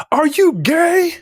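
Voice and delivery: loud, high, drawn-out question; mock confrontation. Acting out a blunt confrontation.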